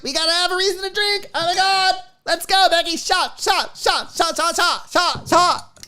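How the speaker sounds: high-pitched, excited drinking chant; mock an over-eager party crowd; party-goer impression. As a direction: squeal it high, rallying friends to drink.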